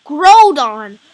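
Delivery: loud, eager name callout; excited announcement. Real, not acted. Announcing the next design loudly.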